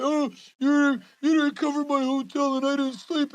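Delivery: hammed-up whining as if imitating a crybaby; mock whiny complaint. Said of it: "fucking complaining, like".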